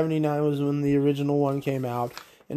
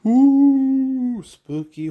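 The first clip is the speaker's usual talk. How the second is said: spooky ooh; 10 semitones higher, 5 dB louder, much slower than usual. Pretend. Spooky tease of horror season.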